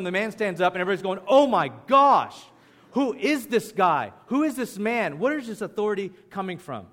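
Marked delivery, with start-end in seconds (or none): 1.3-6.9 astonished crowd. Retold or imitated. Bystanders react to the demon cast out, acted astonishment. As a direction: exclaim like a bystander whose mind is blown. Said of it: "Astonished means they were out of their minds".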